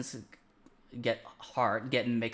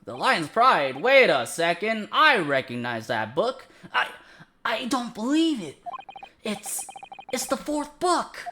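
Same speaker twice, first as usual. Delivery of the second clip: earnest determined anime hero; 8 semitones higher, 4 dB louder than usual. Acted. Reads game lines in character.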